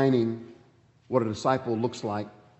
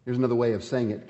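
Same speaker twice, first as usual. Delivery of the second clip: usual speaking voice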